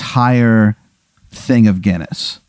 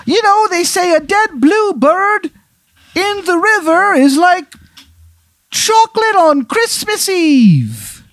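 very high, slow Irish brogue; a leprechaun's mock-wise saying in bad Irish; terrible Irish accent. Leprechaun ghost offering a nonsense Irish saying, terrible accent. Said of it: "His, his accent is terrible".

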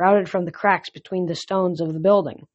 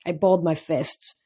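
usual speaking voice

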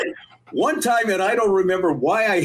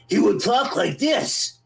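higher, slow put-on Ricky voice; Little Ricky impression; 5 semitones higher, slower than usual. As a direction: talk like Ricky, higher and slower. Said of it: "this impression of Little Ricky".

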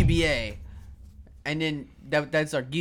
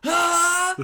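anime power-up yell; 7 dB louder than usual. Pretend.

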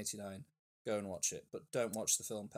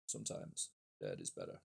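put-on much lower, softer old-man voice; Judd Crandall impression; 8 dB quieter than usual. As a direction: rumble it like a grim old neighbour. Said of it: "by Judd Crandall from Pet Sematary".

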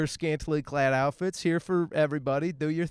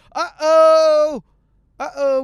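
much higher, loud uh-oh cry; surprised uh-oh; 12 semitones higher, 12 dB louder, slower than usual. Real, not acted. Driver hits a railroad stop, loud high uh-oh. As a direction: yelp at the closing crossing.

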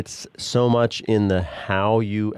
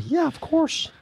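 much higher, lightly voiced doting dad; indulgent parent reply; 3 dB quieter than usual. Dad voiced granting the kids' polite request, mock-sweet and much higher. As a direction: sing out a mock-generous yes, quietly.

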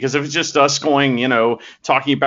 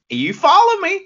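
much higher, put-on Southern accent; a hammed-up Southern accent, pitched way up; Southern accent rallying cry; 3 dB louder than usual. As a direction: call it out to the fans in mock Southern. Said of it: "Southerners with that kind of accent".

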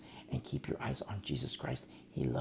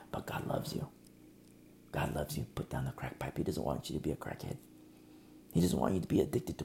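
soft, low, almost whispered; hushed tender plea; 11 semitones lower, 7 dB quieter than usual. Real, not acted. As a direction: lean in and plead gently.

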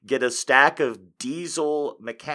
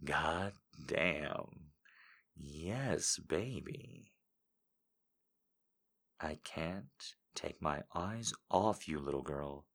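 deep, much lower, soft and slow; seductive voice; 6 semitones lower, 13 dB quieter, slower than usual. Pretend. Reading a viewer quote in a low sultry voice. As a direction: purr it low and intimate. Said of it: "I say it".

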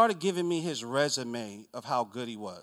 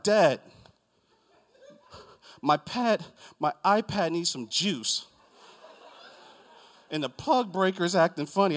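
louder mock tantrum to Dad; imitating an upset kid; complaining kid; 4 semitones higher, 5 dB louder than usual. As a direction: play a child griping over a tiny problem. Voices whining kids' petty complaint.

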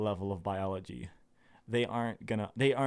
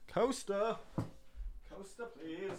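high-pitched call, dropping much softer on the repeat; high playful call; 9 semitones higher, 9 dB quieter, slower than usual. Pretend. Calling for a coaster to save the table, high and playful.

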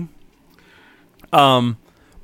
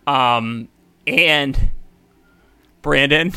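ordinary talk

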